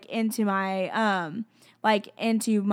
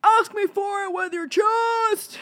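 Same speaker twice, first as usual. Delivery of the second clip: high, drawn-out hammed-up shout; God, hollering; 12 semitones higher, 3 dB louder, slower than usual. God demanding a bolder prayer, playful hollering. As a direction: belt it out as a joke. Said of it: "maybe God's like".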